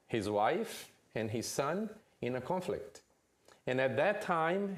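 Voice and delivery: ordinary talk, nothing marked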